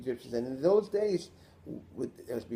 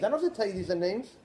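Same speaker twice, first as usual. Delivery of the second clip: ordinary delivery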